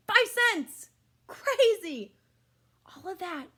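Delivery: amazed excited outburst; astonished. Real, not acted. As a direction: shout it out in amazement. Astonished at the low price.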